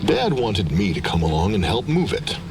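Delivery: ordinary delivery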